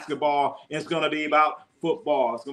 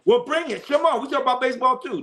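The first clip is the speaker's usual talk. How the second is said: pumped-up streamer hype, much higher; excited hype; 10 semitones higher, faster than usual. Real, not acted. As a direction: fire up the chat: bring it on. Hyping baseball talk.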